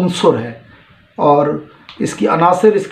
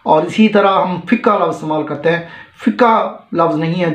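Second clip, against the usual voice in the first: normal talking voice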